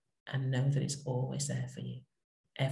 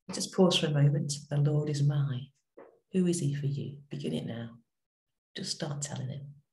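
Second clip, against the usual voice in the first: guided prayer. Real, not acted. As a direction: invite them gently into stillness. Leads a quiet prayer moment.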